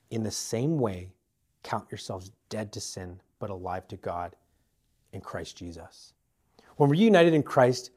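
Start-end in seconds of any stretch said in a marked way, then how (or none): 0.1-6.1 much softer and lower, almost to himself; hushed repeat. Shown as a demonstration. Preacher repeating the verse gently to loved ones, hushed. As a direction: let the verse sink in quietly.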